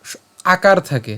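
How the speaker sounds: usual speaking voice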